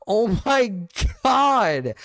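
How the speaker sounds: laugh, then burst out loud and high on God; shocked outburst. Real, not acted. Reacting to stream chat jokes.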